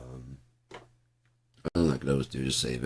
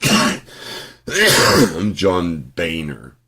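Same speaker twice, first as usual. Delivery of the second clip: John Boehner imitated, drawn out very slowly and louder; mocking imitation; 3 semitones higher, 13 dB louder, much slower than usual. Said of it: "B O E H does not spell fucking bay".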